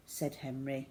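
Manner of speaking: plain reading voice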